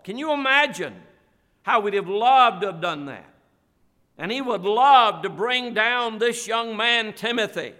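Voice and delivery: impassioned pulpit crescendo; preaching at full force. Acted. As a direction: build the sermon up, pressing it on the congregation. Preaching builds to a climax.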